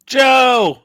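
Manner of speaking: loud, high shout of a name; calling out. Real, not acted. Greeting Joe as he turns up, calling out. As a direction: holler a greeting to a friend. Calls out greeting to arriving viewer.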